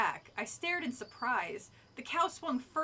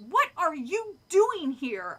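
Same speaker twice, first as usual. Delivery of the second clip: loud, slow shriek; 5 semitones higher, 9 dB louder, slower than usual. A narrator's reading. Boy shrieking at the kids who tricked him. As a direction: yell it out in alarm. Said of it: "I shrieked".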